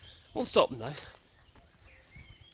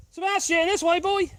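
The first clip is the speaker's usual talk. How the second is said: high, louder call across the field; raised calling voice; calling the dog; 10 semitones higher, 4 dB louder than usual. Real, not acted. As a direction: call the dog back to you. Calling dogs on a walk.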